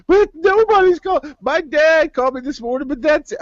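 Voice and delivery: high, whiny mock-sob of the upset friend; hammed-up tearful whine; imitating crying friend. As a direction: play her wailing that nobody called. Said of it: "she started crying".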